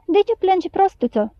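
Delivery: mildly surprised question, starting high. A narrator's reading. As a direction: ask with a touch of astonishment. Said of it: "întrebă doamna mirată".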